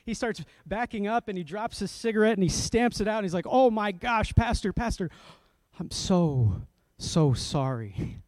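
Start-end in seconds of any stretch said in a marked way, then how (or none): 3.5-8.2 imitated startled apology. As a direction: act out a shocked man apologising profusely. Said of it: "looked like he had seen a ghost".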